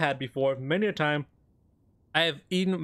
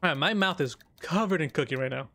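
usual speaking voice

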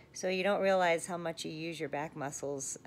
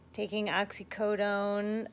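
ordinary delivery

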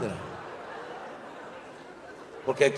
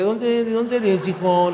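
slow, higher mock question; mocking local asking; 8 semitones higher, 3 dB louder, slower than usual. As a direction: drag it out as a puzzled local. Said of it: "No, no mames".

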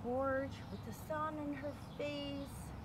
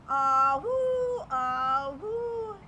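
loud conch blow; 11 dB louder, much slower than usual. Acted. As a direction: blow it like a shell horn. Blowing the conch shell.

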